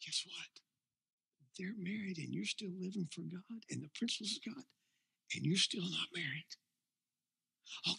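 soft, hushed voice of a devil luring you in; tempter's whisper. Acted. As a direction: whisper it like the devil in your ear. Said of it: "Oh, he whispers".

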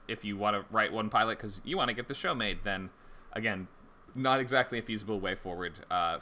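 normal talking voice

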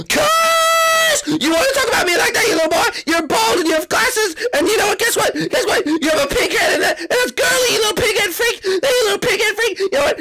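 high, shrieking, tearful rage; tantrum. Acted. Iggy screaming at his brothers that they aren't real friends, furious and tearful. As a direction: scream insults like a child in a meltdown.

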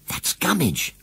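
put-on girl spotting the scarecrow; cried out. A narrator's reading. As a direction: point and blurt it out. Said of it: "cried Susan".

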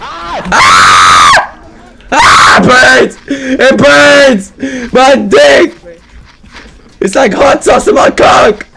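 high, loud hammed-up agony; mock pain scream. Hammed-up scream of pain at teammate's joke. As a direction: scream like it really burns.

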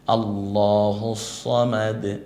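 আল্লাহু সামাদ drawn out slowly with heavy sad; slow Quranic recitation. Shown as a demonstration. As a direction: recite it long and measured. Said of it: "সদটা বলার সময়". (ধীর তিলাওয়াতের সুরে আল্লাহু সামাদ)

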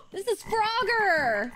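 high delighted gasps; gasping surprise. Real, not acted. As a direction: gasp at discovering Frogger. Discovers frog character.

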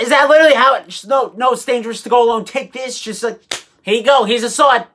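ordinary delivery

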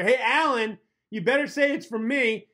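hammed-up yell across the office; mock yell. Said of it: "You'd yell back at your coworker".

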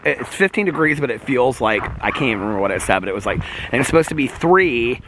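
ordinary talk

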